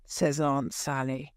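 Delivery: usual speaking voice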